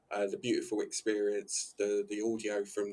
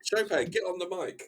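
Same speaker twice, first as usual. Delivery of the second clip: calling out; 9 semitones higher, 4 dB louder than usual. Real, not acted. Calling out to the friend.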